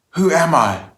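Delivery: high, loud storytelling shout; acted scream. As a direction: cry out the question, pitch falling away. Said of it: "screams out".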